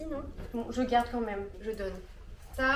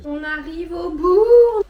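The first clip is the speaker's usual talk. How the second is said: mock triumph; 7 semitones higher, 14 dB louder, much slower than usual. Dramatic sigh nearing the end.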